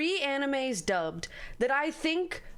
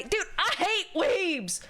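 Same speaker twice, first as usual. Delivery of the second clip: higher, drawn-out exasperated outburst; heated rant; 5 semitones higher, slower than usual. Real, not acted. Heated rant about weebs.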